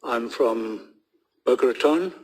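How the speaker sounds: usual speaking voice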